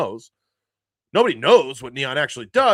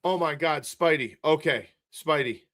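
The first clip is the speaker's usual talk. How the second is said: ordinary talk, nothing marked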